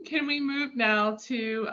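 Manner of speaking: ordinary talk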